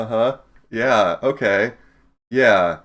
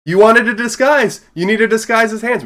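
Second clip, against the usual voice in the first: loud, high excited shout; 11 semitones higher, 8 dB louder than usual. Real, not acted. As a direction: blurt it out with glee. Excited outburst about disguise.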